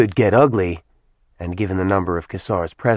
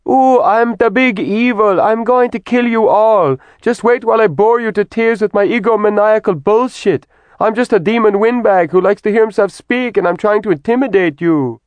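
much higher, louder mock evil-villain voice; 13 semitones higher, 5 dB louder than usual. Kat mocking Kassar's monologue, hammed-up evil voice. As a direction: ham up the big bad demon to ridicule him. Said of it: "mocked Kassar's accent".

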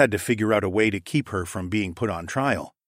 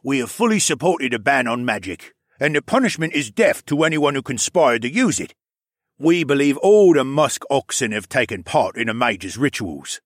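slightly raised, forceful character voice; lightly voiced petitioner; 5 semitones higher, 4 dB louder than usual. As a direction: press the accusation as the crowd's spokesman.

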